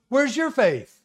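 high-pitched acted rebuke; mock incredulous scolding; exasperated reproach. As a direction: challenge them, imitating a let-down teacher. Jesus rebukes woken disciples.

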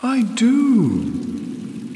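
very high, flute-like goddess voice; mellifluous, fluting voice. A narrator's reading. Water goddess answers her worshippers, high fluting voice. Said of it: "in a mellifluous, fluting voice".

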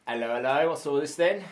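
hammy British bobby voice; mock cockney copper; london policeman.

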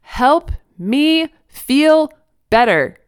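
loud, high, word by word; slow, spelled-out shout. A narrator's reading. Toddler to his dense mother, very slow and loud. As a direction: imitate a child spelling it out for someone dense. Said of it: "spoke more slowly so his dense mother could understand".

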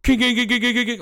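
high, rapid metallic clanking noise; imitated engine racket. Mimicking the truck engine's horrendous rack, high clatter. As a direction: rattle out high-pitched repeated clanks. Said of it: "started and hear this".

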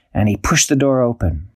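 ordinary delivery, nothing marked

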